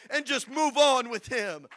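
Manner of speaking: loud, insistent pulpit exhortation; forceful sermon building to a shout; preaching at full force. Real, not acted. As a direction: urge the congregation, punching key words.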